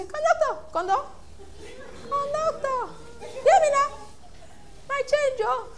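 high, insistent bus-passenger call; calling out to conductor. Pretend. Passenger demanding change from the bus conductor, high insistent call. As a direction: yell for the conductor over the noise.

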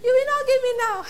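high, laughing demand; demanding change. Pretend. Passenger pressing conductor, high laughing demand.

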